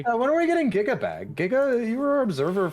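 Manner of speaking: high-pitched call across the room. Real, not acted. Calling out to absent observer.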